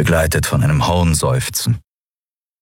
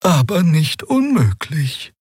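much higher, otherworldly voice; high creature voice; 10 semitones higher, slower than usual. A narrator's reading. Xia hopes the machine still helps, in a high voice. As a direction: float it up high and strange. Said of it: "murmelte Xea".

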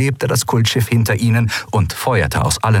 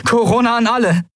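higher, urgent call into the recorder; radio call; 14 semitones higher than usual. A narrator's reading. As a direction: hail everyone over the radio. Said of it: "rief sie in den Kubusaufzeichner". (dringender Funkruf)

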